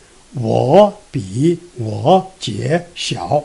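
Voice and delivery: each word slow with a full low dipping third tone in "我比我姐小"; all third tones. Shown as a demonstration. Teacher showing the awkward reading, "我比我姐小" all in third tones. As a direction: drag "我比我姐小" out, dipping and rising on every syllable. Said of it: "all in third tones".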